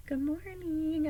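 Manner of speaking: high, slow and sugary good morning; sweet morning voice. Retold or imitated. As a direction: coo it sweetly at the cat. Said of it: "I'm being all sweet with her".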